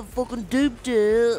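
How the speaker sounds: slow-motion speech. Pretend. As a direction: stretch "fucking duped it" out like a slow-mo replay. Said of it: "what a slow match".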